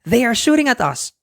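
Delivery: high, urgent warning; alarmed. Acted. Peter warning the flying children, alarmed. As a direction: sound the alarm. Pirates firing at them.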